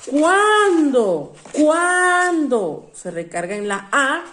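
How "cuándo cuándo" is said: "¿Cuándo?" called out loud and high, falling; loud, drawn-out "¿Cuándo?" for the class; stressed question word. Shown as a demonstration. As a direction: land hard on the accented A of "¿Cuándo?", twice. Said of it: "ahí se acentúa".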